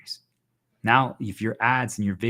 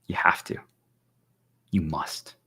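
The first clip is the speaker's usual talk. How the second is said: hushed, lower insistence; whisper; 5 semitones lower, slower than usual. Real, not acted. To stream viewers, insisting they erase their past, whispered. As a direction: breathe the command like a secret. Repeats the command twice.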